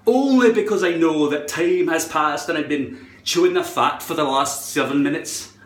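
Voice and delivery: ordinary delivery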